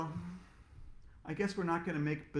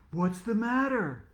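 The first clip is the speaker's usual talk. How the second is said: concerned question; 6 semitones higher, 5 dB louder than usual. Acted. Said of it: "asked Missus Master".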